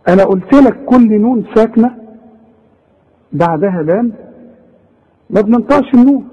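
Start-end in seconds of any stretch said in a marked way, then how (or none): none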